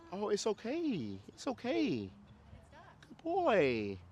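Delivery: high, drawn-out soothing coo; mock coddling. Owner consoling a whining dog, high mock coddling. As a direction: fuss over the dog in a sugary high voice.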